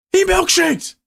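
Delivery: very high, loud disbelieving cry; outraged yell. Real, not acted. Streamer yelling as rival milkshakes. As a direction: shout it in shocked outrage.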